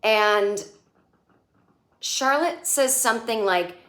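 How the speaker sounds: normal talking voice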